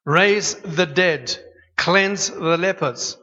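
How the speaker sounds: each word slowed and weighed; slow emphatic. Shown as a demonstration. Preacher stressing the commission, slow and weighty. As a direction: lay out the commands slowly. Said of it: "slow emphatic scripture".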